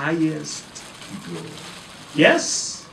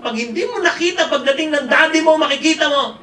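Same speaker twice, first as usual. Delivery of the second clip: high, loud mock threat; scolding parent; 13 semitones higher, 9 dB louder than usual. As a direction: threaten the child at full voice. Parent repeats threat to child. (pasigaw na banta ng magulang)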